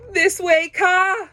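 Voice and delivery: high loud call; shout. A narrator's reading. Fleeing bear calling to the snake, loud shout. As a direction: call out to your friend. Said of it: "shouted Baloo".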